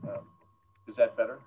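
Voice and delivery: normal talking voice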